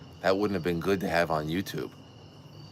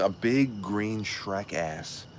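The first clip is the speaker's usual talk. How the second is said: usual speaking voice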